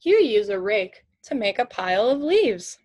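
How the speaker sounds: usual speaking voice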